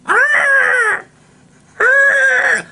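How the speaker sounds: very high, loud shrieking cry; vulture screech. Retold or imitated. Kid imitating a terrifying vulture, high screech. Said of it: "They're all like".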